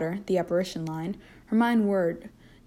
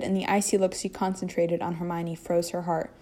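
usual speaking voice

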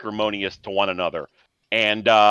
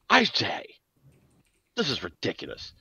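posh I say; 6 dB quieter than usual. Acted. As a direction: sniff like an old English toff. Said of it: "the other guy goes".

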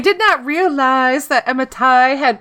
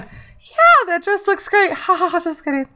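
fake compliment; 6 semitones higher than usual. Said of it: "It would slide, it'd be easier to slide in".

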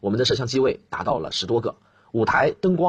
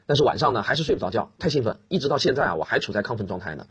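usual speaking voice

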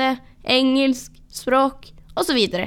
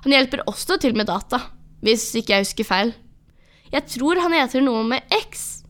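plain reading voice

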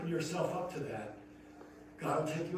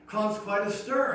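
normal talking voice

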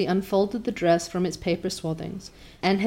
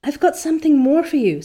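breathless Diana; 8 semitones higher, 6 dB louder than usual. Acted. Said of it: "said Diana breathlessly".

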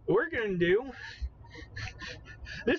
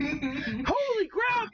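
"Holy crap!" drawn out, much higher, "crap" falling; stunned surprise; 12 semitones higher, much slower than usual. Real, not acted. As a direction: gasp it out in disbelief. Shocked by correct pronunciation.

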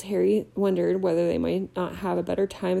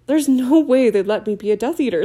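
louder, higher indignant protest, lightly voiced; 4 semitones higher, 7 dB louder than usual. A narrator's reading. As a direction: scoff at the very idea.

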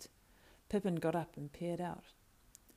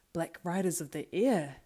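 louder, swelling cry of horror; alarmed exclamation; 7 dB louder than usual. Acted. Pippin realising what flies above the walls, crying out. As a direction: burst out with it in alarm.

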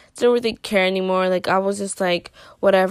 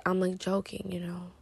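unvoiced whisper, much softer; whispered aside; 12 dB quieter than usual. Real, not acted. Vlogger confiding to viewers, whispered aside. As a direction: breathe it as a secret aside.

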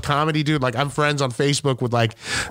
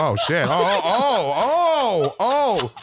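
acting special; 2 semitones higher, much slower than usual. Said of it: "he might think I'm special".

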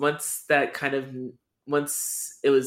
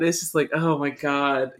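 ordinary talk, nothing marked

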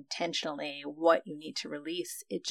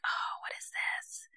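whispered dismay; 9 dB quieter, slower than usual. Retold or imitated. Resistance returns at training.

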